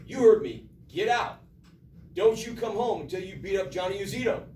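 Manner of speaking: forceful acted scolding; stern angry father. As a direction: act out a father throwing his son out. Father repeats order to crying son.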